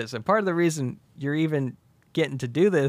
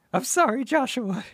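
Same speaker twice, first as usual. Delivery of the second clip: apologetic bride; 10 semitones higher than usual. Retold or imitated. Bride tearfully jilts her groom.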